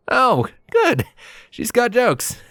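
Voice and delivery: high, wry aside with a pained laugh; pained chuckle. Acted. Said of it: "I chuckled painfully to myself".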